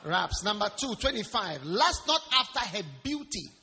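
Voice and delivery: loud, emphatic sermon delivery; preacher projecting to the congregation; forceful preaching. Real, not acted. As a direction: drive the numbered points home loudly.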